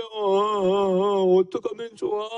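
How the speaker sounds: a crying child's wail, acted lightly; mock crying child. Pastor acts out Minjae crying for the children, mildly. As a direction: play the upset boy, lightly. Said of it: "라고 울고 있어요".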